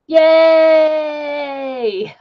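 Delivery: loud high yay; a quick happy cheer; bright little shout of joy. Real, not acted. Cheering a moment in the stream.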